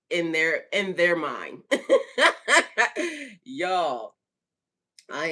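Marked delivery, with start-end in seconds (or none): 1.7-3.4 high, loud peals of laughter; real laughing fit. Real, not acted. Host mocking what people expect, laughing loudly. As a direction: crack up hard.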